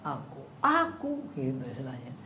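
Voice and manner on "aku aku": high, slow, drawn-out self-announcement; assuming he's known. Retold or imitated. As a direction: answer as if everyone knows you. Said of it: "Kayak yang dikenal aja gitu". (sok dikenal, nada tinggi dipanjangkan)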